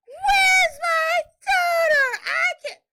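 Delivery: high drawn-out wailing scream; hollering and screaming. Retold or imitated. Wife imitating husband who thinks his child is kidnapped, hollering. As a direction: scream it in full panic, stretching every word. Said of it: "he's hollering and screaming".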